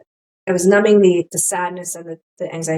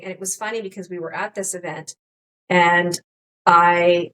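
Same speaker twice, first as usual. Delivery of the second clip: ordinary talk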